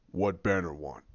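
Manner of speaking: low, soft imitated grump; deep monster voice, kept quiet; Hulk. Hulk answering Banner at the door, low imitated voice. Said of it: "And Hulk just".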